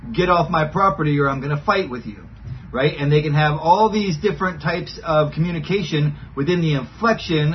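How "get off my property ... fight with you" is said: ordinary talk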